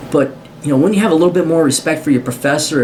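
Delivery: ordinary talk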